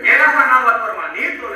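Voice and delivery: put-on loud, fervent preacher's declaration to his child; proclaiming to daughter. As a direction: declare it like a sermon, full voice. Father proclaims faith to sick daughter.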